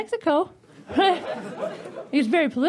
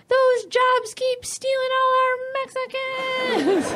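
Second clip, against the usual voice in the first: mock complaint; 9 semitones higher than usual. Mock quote of Mexican workers.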